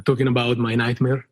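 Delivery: ordinary delivery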